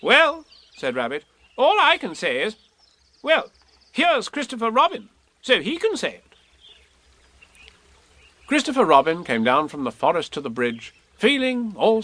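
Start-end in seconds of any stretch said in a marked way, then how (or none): 0.0-6.2 raised, bustling rabbit voice; a busy, fussy rabbit asking. Acted.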